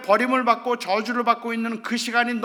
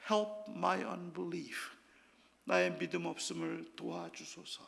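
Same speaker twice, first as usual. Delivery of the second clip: much softer, slow, hushed plea; quiet humble prayer; 5 semitones lower, 12 dB quieter, much slower than usual. Acted. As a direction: let the prayer sink to a soft murmur. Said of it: "아버지가 하는 고백".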